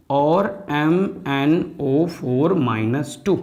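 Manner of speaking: slowly, letter by letter; slow dictation. Shown as a demonstration. Teacher dictating formula to students, slow and deliberate. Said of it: "आराम से समझते चलना".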